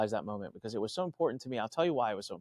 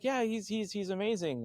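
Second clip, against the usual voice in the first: eager agreement; 8 semitones higher, 5 dB quieter than usual. Retold or imitated. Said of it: "security guard's like".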